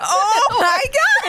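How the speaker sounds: drawn-out shocked gasp; slow, high, loud disbelief. Real, not acted. Shocked at offensive accent attempt.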